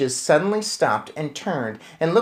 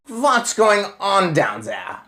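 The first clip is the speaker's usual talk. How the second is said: slow raised witch queen shout; 7 semitones higher, slower than usual. Acted. Witch queen glaring down, shouting. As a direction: demand it from the platform. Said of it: "shouted the Grand High Witch".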